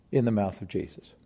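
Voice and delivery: ordinary talk, nothing marked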